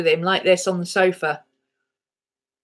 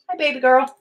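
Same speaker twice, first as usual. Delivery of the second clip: ordinary talk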